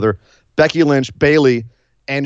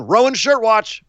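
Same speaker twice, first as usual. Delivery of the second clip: slow, higher announcer read of the title; segment announcement; 9 semitones higher, slower than usual. Shown as a demonstration. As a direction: announce the segment like a show host. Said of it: "announces recurring segment".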